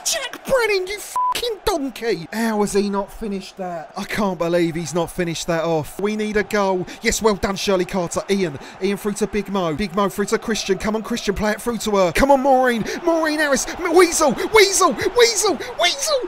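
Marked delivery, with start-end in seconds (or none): 0.0-2.3 exasperated shout. Real, not acted. Striker misses an open chance. 12.1-16.2 rising, louder chant of Weasel into the goal; ecstatic build. Real, not acted. Winning goal in the last minute.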